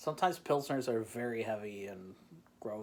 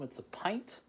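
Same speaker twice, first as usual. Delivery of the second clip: ordinary talk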